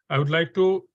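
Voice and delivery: usual speaking voice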